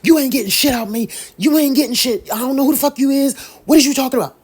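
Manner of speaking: high, loud, fast cussing rant; furious rant at a scam caller on the phone; pretend angry yelling. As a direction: yell at the scammer like you've had enough. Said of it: "You will yell, you will cuss".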